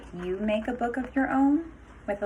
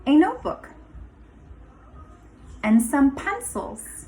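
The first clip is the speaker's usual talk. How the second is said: lively storybook read-aloud with a sing-song lilt; picture-book voice with rising, inviting phrases; reading to children. Acted. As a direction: read it like story time for a class of little kids. Teacher reads picture book aloud.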